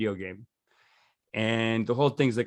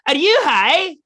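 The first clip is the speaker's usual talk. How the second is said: shrill mocking disbelief, much higher and louder; comic's incredulous jab, pitched way up and loud; scornful comic; 8 dB louder, slower than usual. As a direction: sneer it as the sour comedian. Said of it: "And she goes".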